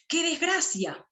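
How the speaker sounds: lamenting exclamation. Shown as a demonstration. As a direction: exclaim it like sudden bad news. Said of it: "Es una exclamación".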